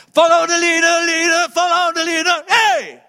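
very loud, high imagined battle cry; rallying shout. Acted. Jesus calling the crowd out of hell, rallying shout. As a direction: holler it out to lead a huge crowd.

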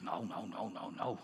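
low, soft doubtful aside; sceptical muttering. Retold or imitated. His sceptical inner reaction, muttered. (sceptisch binnensmonds)

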